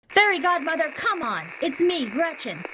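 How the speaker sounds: high, soft and scratchy, like a bad recording; scratchy recorded voice. A narrator's reading. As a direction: her own voice played back thin and scratchy. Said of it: "Gretchen heard a scratchy voice".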